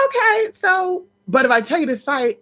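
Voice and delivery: high, wheedling bargaining voice; imitating her friend. Mimicking her friend bargaining to come over, high wheedling. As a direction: sweet-talk like you want something.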